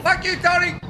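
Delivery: high-pitched echo of an angry shout; mimicked shout. Viewer echoing a clip's "Fuck you, Tony", mimicked shout. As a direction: parrot the angry yell up high. Echoes the shouting in played clip.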